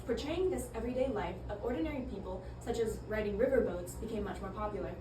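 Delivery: ordinary delivery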